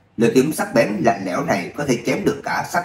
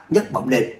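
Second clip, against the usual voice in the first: plain reading voice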